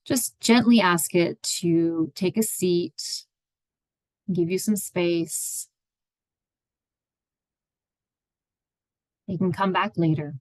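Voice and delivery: unhurried meditation guide, long pauses between phrases; guided meditation. Acted. Therapist guiding listeners into their own space, meditative and unhurried. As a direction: ease listeners into stillness, leaving space after each phrase.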